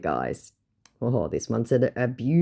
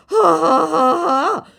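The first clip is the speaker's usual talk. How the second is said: high hammed-up laugh, louder; mock scammer laugh; 7 dB louder, slower than usual. Scammer laughing off the question, mock high laugh. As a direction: laugh him off in a high cackle.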